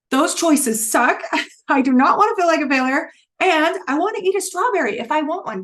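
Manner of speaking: high, loud, laughing protest; fed-up outburst. Retold or imitated. Recalling her Atkins dilemma for viewers, a high loud laughing protest. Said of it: "I'm like".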